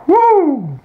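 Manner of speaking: loud, high "woo"; overwhelmed "woo", sliding down; high whoop. Real, not acted. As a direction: let out a high, falling whoop. Exhaling over an attractive woman.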